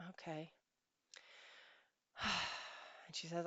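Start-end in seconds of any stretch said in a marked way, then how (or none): 0.1-0.5 very soft, resigned reply; deflated acceptance. Retold or imitated. Wife brushed off by husband, imitated quiet defeat. 2.2-2.8 soft, deflated sigh; resigned sigh. Retold or imitated. Wife after being brushed off, weary sigh.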